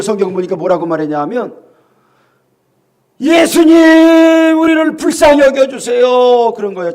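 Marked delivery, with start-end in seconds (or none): 3.2-6.5 loud, drawn-out plea; crying for mercy. Retold or imitated. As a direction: stretch out the call for mercy. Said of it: "소리를 지르는 거예요".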